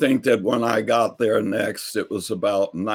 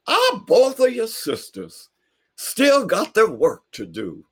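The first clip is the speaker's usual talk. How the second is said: high, louder preaching; praising old man; 11 semitones higher, 4 dB louder than usual. Retold or imitated. Neighbor about the sisters, high preaching. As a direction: proclaim it warmly. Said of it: "Mister Parker said".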